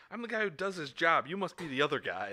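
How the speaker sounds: high, loud cocky comeback; combative movie retort. Retold or imitated. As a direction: fire back as the movie tough guy. Acts out combative film retort.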